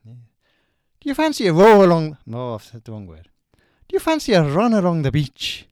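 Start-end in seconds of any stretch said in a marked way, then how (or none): 1.0-2.2 put-on Irish accent. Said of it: "a Irish accent". 3.9-5.7 loud Irish lilt as Oscar Wilde; Irish accent. Acted. Doing Oscar Wilde in an Irish accent for a quiz. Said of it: "a Irish accent".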